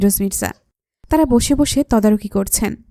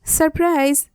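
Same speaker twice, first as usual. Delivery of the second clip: mock group shout; 6 semitones higher, slower than usual. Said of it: "সবাই একসাথে চিৎকার দিয়ে বলে".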